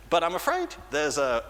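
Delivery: ordinary delivery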